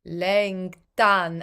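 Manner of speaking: Längtan with a lifted, open ä vowel. Shown as a demonstration. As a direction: lift the ä in Längtan. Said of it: "another lifted vowel".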